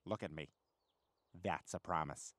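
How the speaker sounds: very low, hushed and deliberate; grave promise. Acted. Man vows to steal the money, low and grave. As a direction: drop right down low and soft to seal it.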